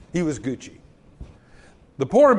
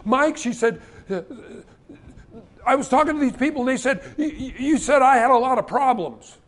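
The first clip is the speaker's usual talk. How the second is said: put-on upset girl; 7 semitones higher, faster than usual. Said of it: "she says".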